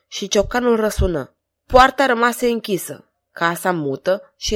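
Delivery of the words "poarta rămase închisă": ordinary delivery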